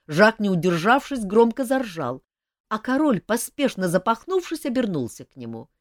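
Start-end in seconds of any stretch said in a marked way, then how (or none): none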